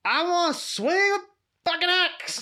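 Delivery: silly primitive brain wanting an axe; caveman brain voice. Pretend. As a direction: bellow it like a primal barbarian. Animal part of brain voiced.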